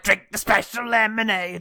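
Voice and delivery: mock command in a put-on voice; Sean Lock impression. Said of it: "It's a good Sean Locke impression".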